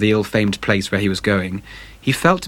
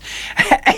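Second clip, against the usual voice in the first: breathy triumphant laugh. A narrator's reading. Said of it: "laughed Dorian Gray".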